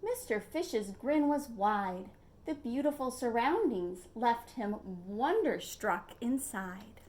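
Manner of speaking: ordinary delivery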